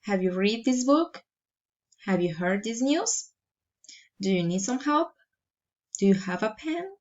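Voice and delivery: yes-no questions climbing to "book", "news", "help", "pen"; rising intonation. Shown as a demonstration. Teacher models general questions, each rising on the last word like "book". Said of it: "raising intonation".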